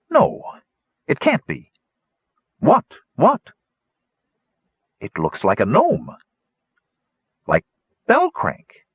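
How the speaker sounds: high, halting astonishment; disbelief. A narrator's reading. Wingover thinks he sees his dead friend, astonished. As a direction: blurt it in pieces as the recognition dawns.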